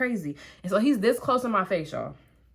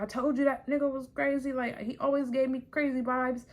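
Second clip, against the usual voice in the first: dad voice; 5 semitones higher, 4 dB quieter than usual. Retold or imitated. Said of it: "My dad was like".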